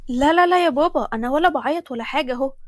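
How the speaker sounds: loud, hasty protest; eager denial. Acted. Daughter denying tears so father will tell the surprise, eager. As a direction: brush it off loudly and eagerly. Hurriedly denies crying to hear surprise.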